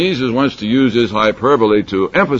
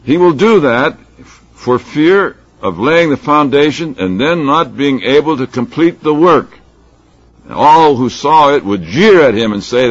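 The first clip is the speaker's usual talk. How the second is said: higher, louder parable reading; scripture reading; 6 semitones higher, 6 dB louder than usual. Acted. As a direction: proclaim the parable to a congregation. Reads scripture aloud.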